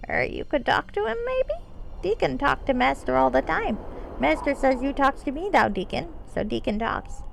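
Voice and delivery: a lightly voiced eager kobold; rough kobold voice. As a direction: speak in broken grammar like a little servant creature.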